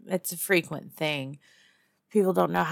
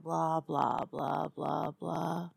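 dismissive blahs; 6 dB quieter, much slower than usual. Acted. Mockingly trailing off a speech.

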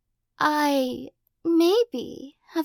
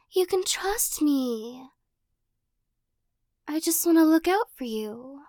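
teasing possessive captor. Acted. Captor pinning the listener against a wall, playful menace. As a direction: toy with them like a cat with a mouse.

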